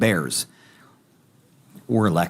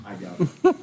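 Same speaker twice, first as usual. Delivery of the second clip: amused; 12 semitones higher than usual. Real, not acted. Teasing reply with laugh.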